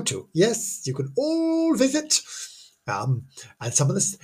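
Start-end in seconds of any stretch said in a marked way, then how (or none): 0.3-2.2 higher, slower sing-song promise; high mock-cheery assurance. Assures listeners they can visit the places, mock cheery. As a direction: sell it like a jolly tour guide.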